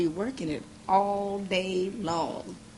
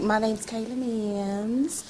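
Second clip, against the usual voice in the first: imitating Southern belle; 3 semitones higher, much slower than usual. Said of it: "you sound like a little Southern belle".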